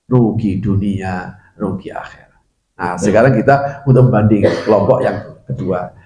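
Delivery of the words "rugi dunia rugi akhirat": hushed warning. Acted. Preacher hushes the congregation with a whispered warning. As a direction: let the warning sink to a whisper.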